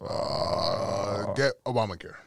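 long hesitant uh before the phrase; halting presidential impression; Barack Obama. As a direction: stall on uh, then state it. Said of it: "Barack Obama".

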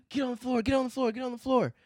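imitating police shouting commands; cops yelling. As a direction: bark it like a cop ordering you down.

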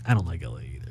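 whispered. Retold or imitated. Retelling his reply to McBride.

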